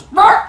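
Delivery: high, loud squawk; sudden cartoon yelp on the final lunge; turtle's straining noise. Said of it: "the turtle goes".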